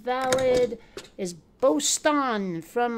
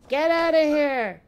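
louder, higher playful shout; mock yell; 5 semitones higher, 6 dB louder than usual. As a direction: shoo them off with a hammed-up holler. Repeats dismissal as mock yell.

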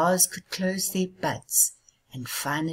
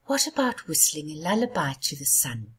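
ordinary delivery, nothing marked

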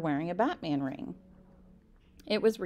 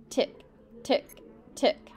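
1 ticking sound; 6 semitones higher, slower than usual. A narrator's reading. Clock ticking sound effect.

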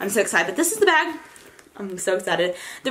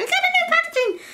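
retold thrill, much higher and in a squeaky put-on voice; excited squeal. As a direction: squeal it like a kid getting a present. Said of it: "I was like".

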